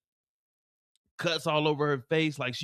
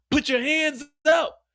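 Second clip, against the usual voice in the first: high, slow cop command; police order; slower than usual. Acted. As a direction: bark it like an arresting officer. Police arresting Vic.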